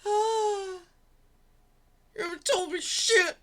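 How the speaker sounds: hammed-up fake cry, high and loud; fake-blubber the line; mocking sob over her words; mock sobbing. Mock sob after tears line.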